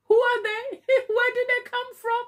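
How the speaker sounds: high-pitched amused bewilderment; baffled wondering. Retold or imitated. Left wondering after friendly strangers move on, comically baffled. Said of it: "I am left wondering".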